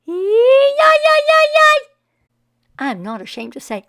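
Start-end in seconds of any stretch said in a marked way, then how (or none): 0.1-1.9 high, loud whooping yahs; wild whooping yell. Acted. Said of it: "He whooped and he hollered and he yelled".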